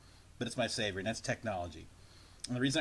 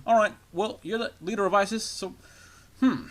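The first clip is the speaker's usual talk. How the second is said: high, hammed-up mock president voice; a bad, pitched-up Obama ordering a bombing; terrible Obama impression; 7 semitones higher, 4 dB louder than usual. Mocking Obama giving orders to the leader of isis, hammed-up. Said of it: "Is this an impression of Barack Obama?".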